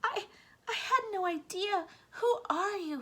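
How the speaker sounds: high, thin, slow mouse voice; a frail little mouse voice, pitched high. A narrator's reading. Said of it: "he asked".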